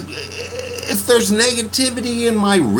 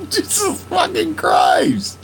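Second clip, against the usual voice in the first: laughing exasperation; 12 semitones higher than usual. Real, not acted.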